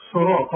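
صراط loud, with thick s, r and final t; heavy letters. Shown as a demonstration. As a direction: hit صراط with full emphatic letters. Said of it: "برضه اتفقنا". (صراط بتفخيم قوي)

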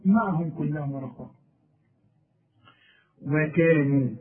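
usual speaking voice

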